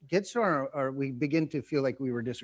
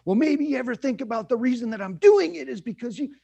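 higher, louder defensive fire-back; mock retort; 7 semitones higher, 6 dB louder than usual. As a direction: snap back at the accuser. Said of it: "fires back with whatever they had".

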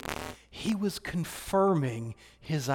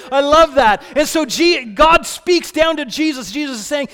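louder, higher impassioned preaching; fervent pulpit preaching; preaching at full force; 8 semitones higher, 9 dB louder than usual. Real, not acted. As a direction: preach it with rising fire.

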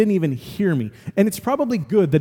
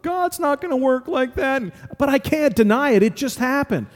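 high, stunned disbelief; amazed disbelief; 7 semitones higher than usual. Retold or imitated. Pastor recalling his stunned thought to congregation, high amazed. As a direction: marvel aloud in high voice. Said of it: "my first thought is".

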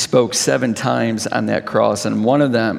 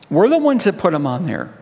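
ordinary talk

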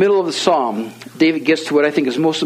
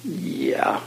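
near-whispered, reluctant yes; hushed admission; 8 semitones higher, 7 dB quieter than usual. Retold or imitated. Young man admitting he wanted money, in a guilty whisper. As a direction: imitate a guilty confession, barely voiced.

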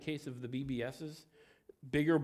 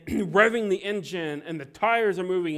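normal talking voice

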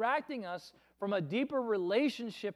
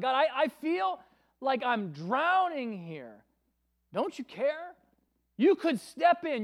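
desperate plea; 6 semitones higher, 4 dB louder than usual. Acted. Voicing a desperate prayer to God.

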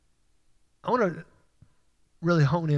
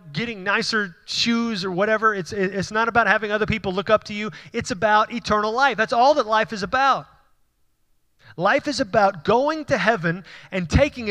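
higher, rising preacher's fervour; preaching; 4 semitones higher than usual. Acted. Pastor pressing the congregation about life's purpose, fervent preaching. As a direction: drive the point home from the pulpit. Preaching builds to full force.

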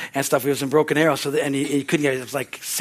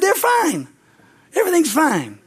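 1 reassuring; 9 semitones higher, 3 dB louder than usual. Retold or imitated. Reassuring siblings.

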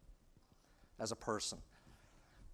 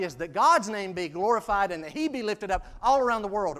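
loud, raised declamation of a prayer; full-force preaching; 5 semitones higher, 8 dB louder than usual. Real, not acted. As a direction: proclaim it fervently from the pulpit.

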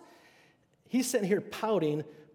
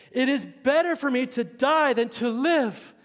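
higher, louder, slow sulky complaint; milk Jonah's self-pity; whiny Jonah; 7 semitones higher, 7 dB louder, slower than usual. Acted. Preacher voicing Jonah begging to die, hammed-up self-pity.

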